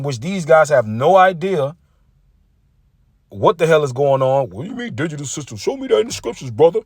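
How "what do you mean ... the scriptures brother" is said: quick, hushed then a pitched-up squeak of a challenge; lightly voiced imitation of a new preacher; mocking a rookie preacher. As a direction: mimic a green preacher demanding proof. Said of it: "talking like this".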